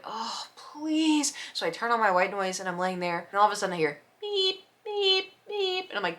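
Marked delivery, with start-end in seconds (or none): 0.0-1.3 exasperated. Retold or imitated. Annoyed at noise at night. 4.8-5.9 tow truck reverse beep, pitched high. Retold or imitated. Mimicking a tow truck backing up at night, high beeps.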